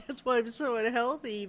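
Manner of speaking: normal talking voice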